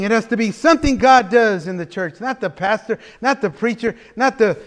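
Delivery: ordinary talk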